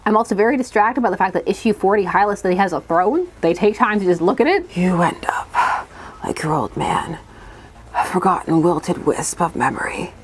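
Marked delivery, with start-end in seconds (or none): 4.7-10.1 low, slow whispered villain; slow theatrical villain menace. Acted. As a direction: hiss it out like a cartoon villain. Reading villain dialogue aloud.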